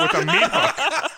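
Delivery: real laughter breaking through, high and loud; cracking up. Real, not acted. Friend telling others of a gory movie moment, cracking up. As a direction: laugh helplessly through the words.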